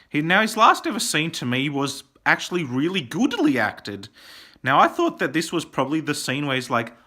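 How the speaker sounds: ordinary talk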